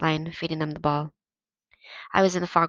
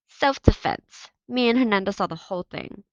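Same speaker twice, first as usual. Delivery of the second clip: smug; 3 semitones higher, 7 dB louder than usual. Acted. Victor backs up the story.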